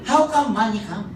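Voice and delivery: high teasing pidgin question; lightly mocking skeptics; imitating doubters. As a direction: tease him like old friends. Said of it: "they will tell me".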